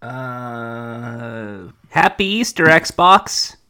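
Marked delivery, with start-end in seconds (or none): 1.9-3.6 loud. Pretend. Mock loud greeting.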